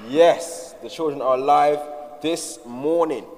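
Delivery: loud, slow rallying cry; excited shout. Real, not acted. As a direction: rouse the room. Rallying the crowd.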